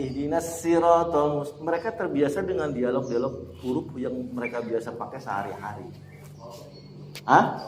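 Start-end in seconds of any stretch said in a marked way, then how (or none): 0.0-1.6 "siratal" read slowly with a Javanese-coloured "irotol"; slow recitation in the local dialect. Shown as a demonstration. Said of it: "ada yang".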